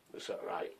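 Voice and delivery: breathy whisper to himself, barely audible; whispered aside. Real, not acted. Sorting his kit, he whispers to himself. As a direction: drop to a whisper, just for yourself.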